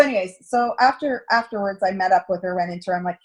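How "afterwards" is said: ordinary talk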